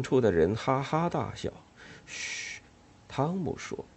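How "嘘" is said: whispered shush; hush. Acted. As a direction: hush her urgently. Tom hushing Ellen.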